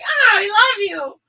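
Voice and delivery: high, loud, slow mock swoon; hammed-up overjoyed reply to an insult; gushing delighted shout. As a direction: squeal it out like a smitten fan. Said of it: "I'm like".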